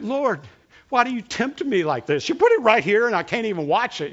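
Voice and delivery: loud, high retold complaint to the Lord; exasperated plea. Preacher retelling his exasperated prayer to God, loud and high. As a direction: throw the frustration up at heaven.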